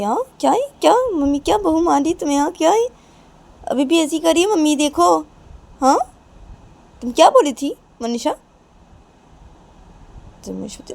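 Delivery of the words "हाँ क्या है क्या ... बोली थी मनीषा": loud, high, insistent questions; heated taunting. Acted. Nisha argues about mother accepting Manisha, heated. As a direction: keep hammering the point angrily.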